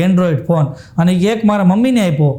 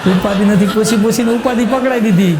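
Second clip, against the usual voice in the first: laughing through it. Real, not acted. Punchline retold while laughing.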